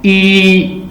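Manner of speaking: ई with lips spread wide; teacher modelling a spread vowel; vowel ee demo. Shown as a demonstration. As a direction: pull the lips back for ई. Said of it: "इसको कहते हैं spread".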